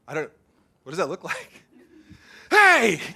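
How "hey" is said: put-on high, loud little kid calling out; excited kid shout. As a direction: burst in like a kid. Kid bursting in on dad.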